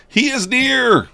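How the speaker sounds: high, steady mock-ominous "He is near"; ominous announcer. Trader joking about the coming murder bot, ominous voice. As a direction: tease a looming threat in a put-on voice.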